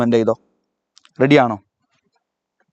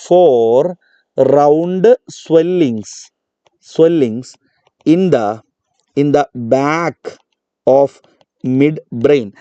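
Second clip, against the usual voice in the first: slow dictation; much slower than usual. Shown as a demonstration. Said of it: "dictating to the class".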